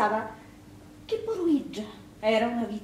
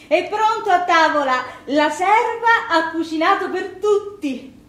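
loud, higher and slow sarcastic call; bitter mockery; 7 semitones higher, 10 dB louder, slower than usual. Wife mocks husband summoning the servant to dinner, loud sarcastic call. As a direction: spit out the mock announcement.

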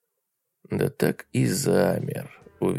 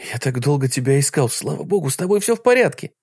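fast, rising to a high joyful cry; relieved excitement; 6 semitones higher, 4 dB louder, faster than usual. A narrator's reading. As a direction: blurt it out with relief. Relieved reunion with wife. (радостное облегчение)

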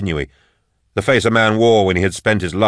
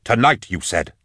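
mildly indignant, pitched up; terse irritated reminder; spat out; 3 semitones higher than usual. A narrator's reading. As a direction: throw their promise back at them. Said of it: "Endor spat".

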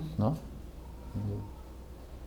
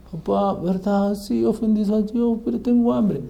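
mock crying child; 8 semitones higher, 5 dB quieter than usual. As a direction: imitate a tearful child begging. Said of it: "¿Por qué estás llorando?". (lloriqueo infantil imitado, lento y agudo)

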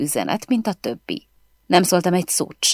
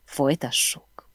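ordinary delivery, nothing marked

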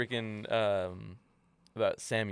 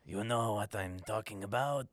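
hissing reptilian alien, lightly voiced; a hint of a Star Wars lizard; sly sticky chameleon. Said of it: "the Star Wars lizard voice".

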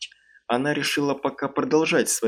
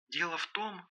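put-on much softer, higher girl on the phone; hesitant; 5 semitones higher, 15 dB quieter than usual. A narrator's reading. Девушка на том конце провода колеблется, hesitant. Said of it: "Девушка на том конце провода заколебалась".

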